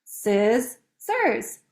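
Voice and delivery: "scissors" broken in two with a clear gap; counting out syllables. Shown as a demonstration. Teacher counting syllables with pupils, "scissors" broken apart. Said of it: "let's count".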